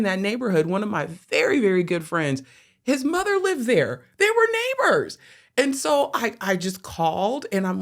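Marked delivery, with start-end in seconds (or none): 2.8-5.2 amazed. Real, not acted. Telling of a friend's mother living on that block, amazed. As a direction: pitch soaring in real amazement.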